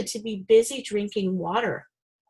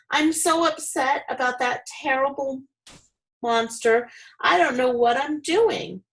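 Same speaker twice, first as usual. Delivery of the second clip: higher, fretful wife's voice; a touch of nerves; nervously; 6 semitones higher than usual. A narrator's reading. Upset wife fretting to her husband, mildly nervous. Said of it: "she said nervously".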